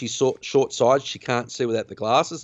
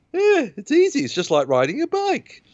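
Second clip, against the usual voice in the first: gleeful; 14 semitones higher than usual. Retold or imitated. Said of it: "and he's laughing".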